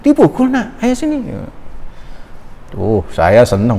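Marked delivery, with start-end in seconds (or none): 0.0-1.2 very high-pitched imitated alarm; startled grandmother. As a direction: act out grandma's shocked concern. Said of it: "Umi saya kaget".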